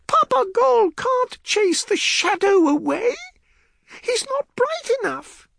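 put-on very high, loud child voice; insistent little girl. A narrator's reading. Child explaining about Papa Gol, insistent. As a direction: set a grown-up straight in a small girl's voice.

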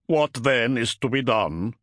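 slow, gruff warrior voice. A narrator's reading. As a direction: ask it gruffly, drawn out. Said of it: "the Wolf Guard asked gruffly".

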